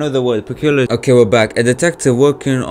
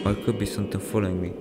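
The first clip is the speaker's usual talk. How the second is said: whispered; 3 semitones lower, 13 dB quieter, much faster than usual. Real, not acted. Scared quiet rush.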